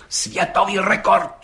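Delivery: slow, high, ceremonious "Světový rekord"; solemn repeat. A narrator's reading. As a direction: announce "Světový rekord" like a proclamation. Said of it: "opakoval četník Hejda slavnostně".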